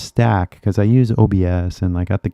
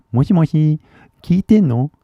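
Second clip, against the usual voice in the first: teasing moshi moshi; 5 semitones higher than usual. Pretend. Teasing the girl in Japanese.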